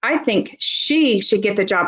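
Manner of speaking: "she" stretched and set apart with emphasis; stress on SHE. Shown as a demonstration. Teacher showing how stress changes meaning, emphasis on "she". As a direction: lean hard on the word "she". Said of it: "We could emphasize any of those".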